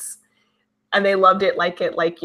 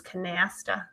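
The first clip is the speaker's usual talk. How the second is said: "canasta" with a slight Boston twang; grandma's Boston-like accent; 8 dB quieter, slower than usual. Retold or imitated. Granddaughter copies grandma saying "canasta", light Boston-like accent. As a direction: imitate a Boston-tinged grandma on "canasta", drawn out. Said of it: "a little bit of that Boston kind of an accent".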